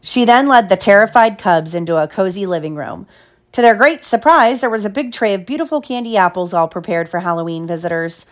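ordinary delivery

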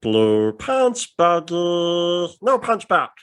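little blue alien chatter; Stitch. Acted. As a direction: stretch it like a cartoon creature. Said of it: "I can do the Stitch voice".

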